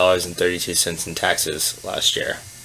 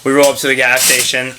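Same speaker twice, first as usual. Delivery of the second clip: normal talking voice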